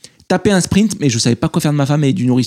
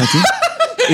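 laughing. Real, not acted. Laughing mid-story.

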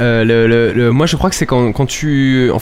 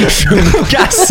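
laughing mock exit; much slower than usual. Acting Greg storming out.

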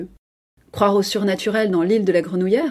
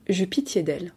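usual speaking voice

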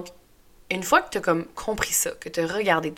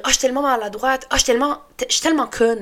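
mock self-scolding; 6 semitones higher, 5 dB louder, faster than usual. Mimics her harsh self-talk.

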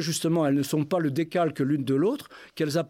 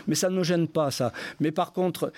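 ordinary talk, nothing marked

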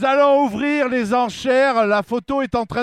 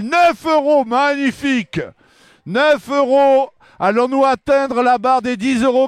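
auctioneer; 3 semitones higher, 4 dB louder than usual. Acted. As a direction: call the bids out to the room. Auction calling out bids.

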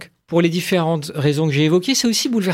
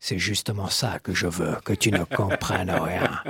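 put-on Hitchcock accent; 7 semitones lower, 6 dB quieter than usual. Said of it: "j'ai un peu bossé mon accent hitchcockien".

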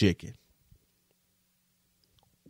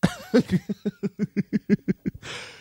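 high, amused laugh; a hearty laugh at the punchline; real laugh; 9 semitones higher than usual. Real, not acted. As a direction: laugh at your own story. Laughs at own story.

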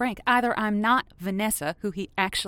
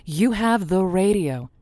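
upbeat on-air radio host, a touch louder; 4 dB louder than usual. Acted. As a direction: sell it like a late-night call-in DJ.

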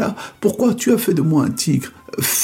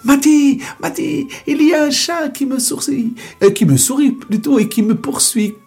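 panicked cry; 11 semitones higher than usual. Acted. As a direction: scream for help while fleeing. Mouse chased, panics.

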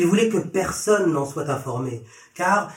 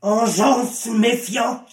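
slow, raised-pitch reproach; exasperated rebuke; 6 semitones higher, much slower than usual. A narrator's reading. Jesus rebuking the faithless crowd, exasperated. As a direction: draw it out in exasperation.

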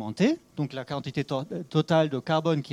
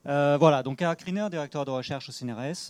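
louder restart, up to the mic; speaking louder. Real, not acted. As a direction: repeat it louder so the room hears. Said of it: "il faut que je parle plus fort".

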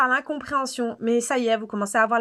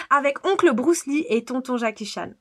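normal talking voice

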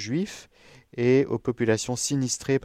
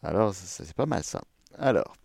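normal talking voice

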